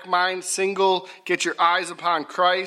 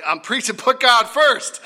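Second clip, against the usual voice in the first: mock preacher shout; 7 semitones higher, 5 dB louder than usual. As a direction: shout it like a playful pulpit retort. Acted joking retort to his boys.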